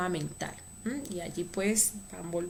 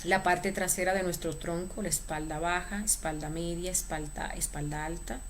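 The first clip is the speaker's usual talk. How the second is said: guided meditation. Acted. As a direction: lead them gently through the body. Leads a relaxation meditation.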